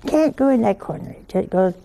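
ordinary talk

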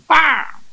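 sharp mock "pah" of a frying pan hit; pan smack sound. Pan strike sound in joke.

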